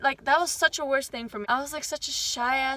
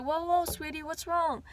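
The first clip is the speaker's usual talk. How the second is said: higher, softer, tender concern; soothing teacher; 5 semitones higher, 4 dB quieter than usual. Retold or imitated. Kind homeroom teacher soothing a crying student, tender and high. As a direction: comfort a crying child.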